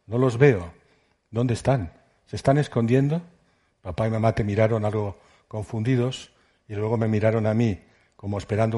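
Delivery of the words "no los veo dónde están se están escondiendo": ordinary delivery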